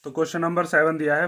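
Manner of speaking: ordinary delivery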